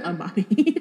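laughing out the name; real laughter. Real, not acted. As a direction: break up laughing mid-word. Laughing at the joke.

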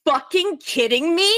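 retold shout of disbelief at the cashier; furious yell. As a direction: snap at her, not believing it. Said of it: "I've never screamed "fuck" so loud in my life".

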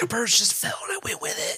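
play-acted whispered excuse; hushed pretend confession; whispered mock confession. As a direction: whisper it like a guilty admission.

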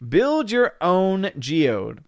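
slow, high ad pitch; hammed-up advertising voice; mock ad slogan. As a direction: sell it like a toy commercial. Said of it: "It'll be".

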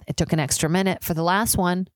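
usual speaking voice

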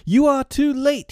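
put-on high, loud boyish boast; proud cry. A narrator's reading. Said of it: "he cried proudly".

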